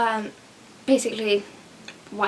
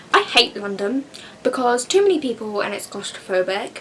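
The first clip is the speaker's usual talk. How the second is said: usual speaking voice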